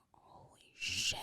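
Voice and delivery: slow, whispered shock; stunned whisper. Retold or imitated. Reacting to learning she has a sister, stunned whisper. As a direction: whisper it slowly, as if floored.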